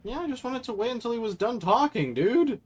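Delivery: very high-pitched squeaky complaint; high, indignant "dude" whine; exasperated protest. Real, not acted. Streamer protests at game character's prompt.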